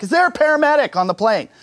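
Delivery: desperate call for a paramedic; frantic call. Retold or imitated. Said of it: "She's like".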